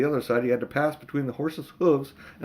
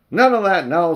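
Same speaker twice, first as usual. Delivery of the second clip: louder, higher stern rebuke; 6 semitones higher, 9 dB louder than usual. Acted. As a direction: shut him down sharply. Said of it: "said the policeman sternly".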